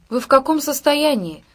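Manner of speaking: loud sharp reprimand; stern officer. Acted. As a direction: dress down the drunk sailors. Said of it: "строжился дежурный офицер".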